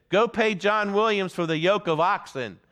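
a plain-spoken neighbour calling out; a neighbour calling out from the back of the meeting room; neighbour's retort. Retold or imitated.

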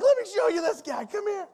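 high, eager calling out; grabbing family to show him off; excited call. Retold or imitated. Preacher imagining greeting family in heaven, excited and high.

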